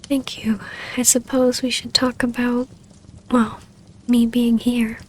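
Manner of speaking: ordinary talk, nothing marked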